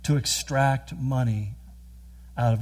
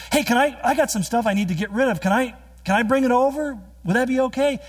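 higher, mock-friendly neighbor voice; chirpy neighbor; 9 semitones higher, faster than usual. Neighbor calling over about the garage sale, perky and high. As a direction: pitch it up like a cheery neighbor.

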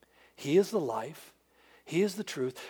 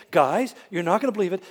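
louder, eager announcement; excited; 2 semitones higher, 8 dB louder than usual. Retold or imitated. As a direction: burst in with news. Women excitedly repeat same line.

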